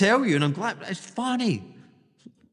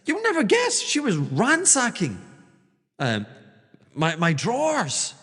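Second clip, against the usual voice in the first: mock outraged broker; 6 semitones higher than usual. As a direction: squeal it out like a scandalised gossip. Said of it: "he's like".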